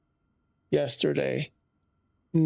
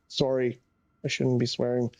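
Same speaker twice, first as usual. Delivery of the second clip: ordinary talk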